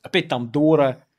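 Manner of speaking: loud, high hammed-up protest shouting; hammed-up indignant fan outcry; mock outrage. Mock outrage defending singer [name].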